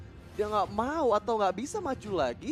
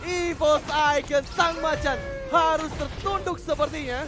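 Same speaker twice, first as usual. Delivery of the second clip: hyped play-by-play caster; fighting game announcer; 6 semitones higher, 5 dB louder than usual. Acted. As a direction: call the wipe out like an arena announcer. Live play-by-play during teamfight.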